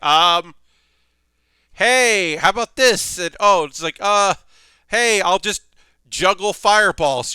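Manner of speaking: high, loud, nervously improvising; a panicked mentor who has no idea how to comfort a crying girl; lightly played, flustered; flustered Fran. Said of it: "does not know what to do with a crying girl".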